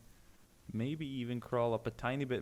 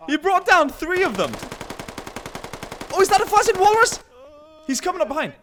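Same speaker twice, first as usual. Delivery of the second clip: loud, much higher and fast; excited commentary; 11 dB louder, faster than usual. Real, not acted. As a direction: call it like a goal. Excited play-by-play climax.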